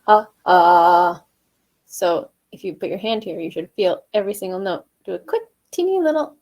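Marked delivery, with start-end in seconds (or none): 0.1-1.2 short loud sung ha; staccato ha. Shown as a demonstration. Coach showing students a staccato ha. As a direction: punch out a quick ha. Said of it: "vocal coach demos staccato".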